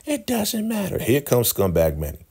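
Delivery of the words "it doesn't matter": very high falsetto imitation; Joan. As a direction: squeal it in a put-on falsetto. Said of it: "You know how Joan talks".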